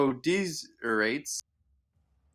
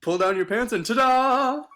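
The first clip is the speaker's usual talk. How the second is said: playful ta-da; 10 semitones higher, 5 dB louder than usual. Pretend. Joking reveal.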